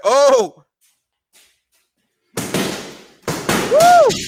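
high loud whooping cheer; excited whoop. Real, not acted. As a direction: let out a big excited woo. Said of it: "I'm just too excited".

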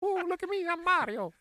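high, hammed-up Italian Mario voice; mock Italian accent. Mocking how Mario is voiced, in a high put-on Italian accent. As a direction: squeak it like a cartoon Italian plumber. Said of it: "Italian racism".

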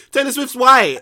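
high, loud laughing outburst; laughing exclamation. Real, not acted. Host answering why [name] won, laughing exclamation. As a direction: shout it through laughter.

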